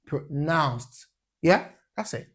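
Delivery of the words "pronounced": "pronounced" slow, weight on -nounced; "pronounced" with second syllable stress. Shown as a demonstration. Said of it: "stressed on the second syllable".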